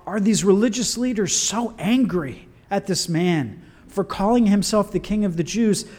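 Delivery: normal talking voice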